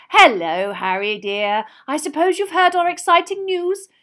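loud, high motherly greeting; excited, fond mother; proud mother. A narrator's reading. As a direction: gush with pride at the news. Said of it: "swelling with pride".

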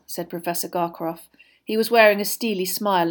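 plain reading voice

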